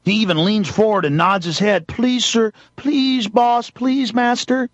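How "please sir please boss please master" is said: high, meek supplicant voice; mock servile begging. Man bowing to the cop, mock servile pleading. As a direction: grovel in a raised voice, in imitation. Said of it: "doesn't like him begging him".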